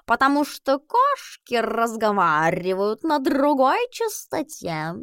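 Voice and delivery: slow, high drawling cat. Acted. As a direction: stretch every word like a lazy cat. Albus the cat explains.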